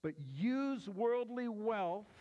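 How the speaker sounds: "use" stretched slow and weighted; stress on use. Shown as a demonstration. Preacher restating the verse, leaning on "use". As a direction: dwell on "use" in a slow careful phrase. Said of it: "Emphasis on the word use".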